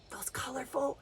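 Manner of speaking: unvoiced, hushed wonder; awed whisper. Real, not acted. Teacher whispering in awe about parrots.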